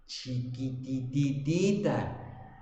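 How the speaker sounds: chiquitititita stretched slowly, syllables piled up to sound tiny; drawn-out diminutive. Acted. Preacher belittling the small debt to his congregation, playfully stretched. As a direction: draw out chiquitititita slow and playful. Stretching word to mock tininess.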